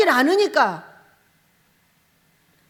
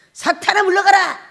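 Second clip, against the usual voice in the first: higher, loud commanding rebuke; stern rebuke; 7 semitones higher, 8 dB louder than usual. Retold or imitated. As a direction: cast Satan out with authority. Jesus rebuking Peter.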